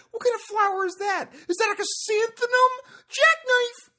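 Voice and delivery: very high, loud catchphrase shouting; Dane Cook. Retold or imitated. Imitating a sketch impression of a comic, high loud yell. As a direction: shriek it like a hyped-up stand-up comic. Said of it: "Charlie did a Dane Cook thing where he's like".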